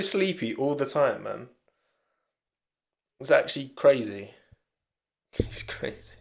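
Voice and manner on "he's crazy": breathy laugh through the words. Real, not acted. Streamer laughs at his dozing dog, quiet laughing. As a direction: giggle it out, almost no voice.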